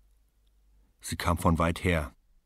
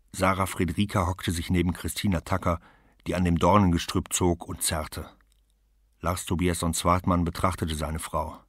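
ordinary delivery

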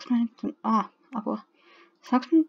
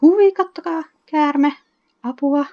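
startled shriek; 7 semitones higher, 7 dB louder than usual. Real, not acted. Spots a snake in game.

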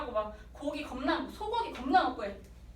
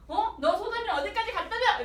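higher taunting cop; pushy cop; 6 semitones higher, 3 dB louder than usual. Retold or imitated. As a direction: prod him with a rising taunt. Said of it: "이렇게 하는 거지".

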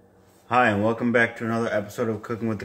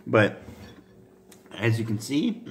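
ordinary talk

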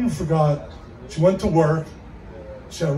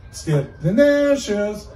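slow, higher call through the door; slow drawn-out delivery guy; 4 semitones higher, much slower than usual. Acted. Delivery guy answering the parrot again, slow and drawn out. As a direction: drag the words out patiently.

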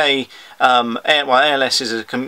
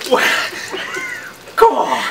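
psyching up. Real, not acted. Replay of bracing moment.

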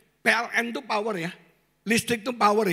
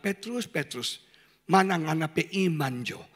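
Jesus speaking gently; 7 semitones lower than usual. Acted. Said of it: "Yesus bilang".

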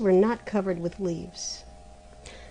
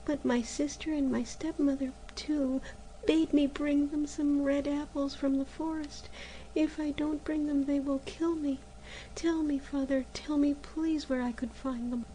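higher, softer, sorrowful begging; sad pleading; 6 semitones higher, 7 dB quieter than usual. Acted. Girl sadly begging January for help. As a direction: plead gently with a frightened heart. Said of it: "said Maruschka sadly".

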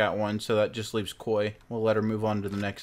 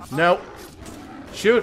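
frustrated shout; 6 semitones higher, 4 dB louder than usual. Real, not acted. Game mishap.

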